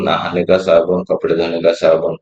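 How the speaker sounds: ordinary talk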